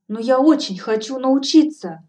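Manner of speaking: a heroine eager to learn, fervent. Acted. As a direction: say it passionately, wanting it badly. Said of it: "горячо сказала я".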